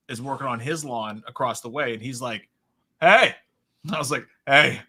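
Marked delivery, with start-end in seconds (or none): 3.0-3.3 loud, higher neighbourly hail; calling out. Retold or imitated. Neighbour hailing a shoveller across the way, calling out. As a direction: call it across the lawn.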